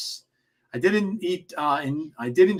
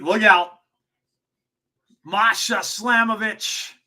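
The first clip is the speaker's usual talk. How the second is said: wrestling commentator; 4 semitones higher than usual. Real, not acted. Live match call continues.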